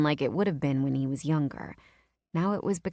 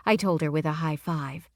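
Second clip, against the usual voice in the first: plain reading voice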